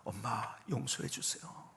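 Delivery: soft, slow retold tearful plea. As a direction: plead for forgiveness, imitating quiet tears. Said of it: "울면서 이렇게 얘기했어요".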